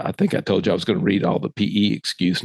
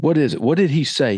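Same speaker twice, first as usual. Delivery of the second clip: upset demand; 4 semitones higher than usual. Retold or imitated. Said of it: "I'm too upset".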